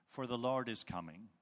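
usual speaking voice

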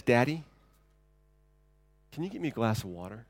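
put-on a sleepy five-year-old asking sweetly; lightly voiced child request; small child asking. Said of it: "she says".

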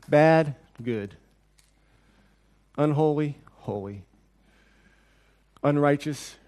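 measured judging, pausing between each verdict; weighing opposites. Acted. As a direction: step down in pitch from each word to its opposite. Acts ruler ranking bad against good.

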